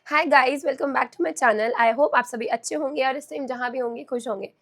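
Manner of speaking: cracked, strained hoarse greeting; hoarse sick voice. Real, not acted. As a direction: push words out through a sore throat. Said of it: "मेरी फटी हुई speaker जैसी आवाज़".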